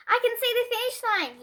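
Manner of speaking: high, eager call near the finish; thrilled to be winning the race; excited cry. A narrator's reading. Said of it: "he cried".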